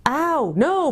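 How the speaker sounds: high, pained bellow at modest volume. A narrator's reading. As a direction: cry out in indignant pain. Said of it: "bellowed Trumpkin".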